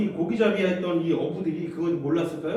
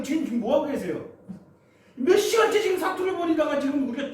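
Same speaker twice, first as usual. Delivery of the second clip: resentful complaint; 5 semitones higher than usual. Acted. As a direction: scold the sleeping Lord for doing nothing. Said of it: "누가 봐도 지금 화내고 있어요". (원망 섞인 항의)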